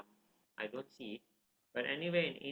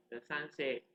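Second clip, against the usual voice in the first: ordinary delivery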